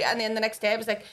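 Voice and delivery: ordinary talk, nothing marked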